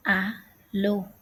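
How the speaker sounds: "A lo" starting straight on the vowel; "A lo" as Hallo without the H; silent H. Shown as a demonstration. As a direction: leave the H off, "A lo". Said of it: "H is not pronounced".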